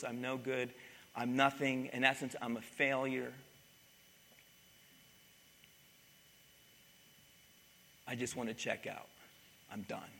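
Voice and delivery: despairing Elijah. Retold or imitated. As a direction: let each phrase fall away, defeated. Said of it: "he just cries out to God and he says".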